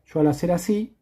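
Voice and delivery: normal talking voice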